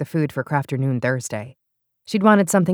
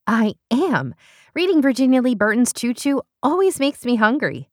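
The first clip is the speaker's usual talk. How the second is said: bright higher eager voice; perky and eager, pitched up; Beth's voice; 8 semitones higher, 4 dB louder than usual. Acted. Beth answers eagerly.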